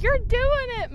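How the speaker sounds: high cheerful chant of encouragement; cheering self on. Retold or imitated. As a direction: cheer yourself on. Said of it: "another one of, like".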